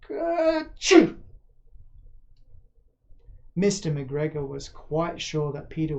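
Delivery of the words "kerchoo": high, loud acted sneeze; a sudden explosive sneeze. Rabbit hiding in the watering can, sneezing loudly. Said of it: "Presently Peter sneezed".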